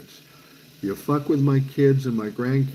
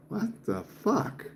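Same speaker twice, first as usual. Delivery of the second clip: mock-confused, high and slow; baffled double take; 11 semitones higher, 7 dB quieter, slower than usual. Stranger meeting him, puzzled head tilt, slow high baffled. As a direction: play a stranger thrown off, drawing it out high.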